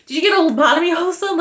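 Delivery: a rapid incredulous rant; exasperated outburst. Shown as a demonstration. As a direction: rattle it off in disbelief. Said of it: "but here we go".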